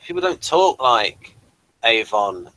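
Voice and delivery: ordinary talk, nothing marked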